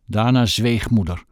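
plain reading voice

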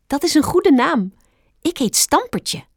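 high, excited little rabbit; exclaiming. A narrator's reading. Young rabbit introducing himself to Bambi, exclaiming. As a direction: introduce yourself eagerly, pitched high. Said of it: "riep het konijn uit".

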